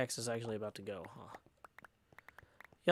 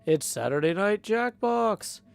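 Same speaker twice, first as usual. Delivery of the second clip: loud, slow TV announcer; 7 semitones higher, 8 dB louder, slower than usual. Acted. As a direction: boom it like a show intro. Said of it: "who says".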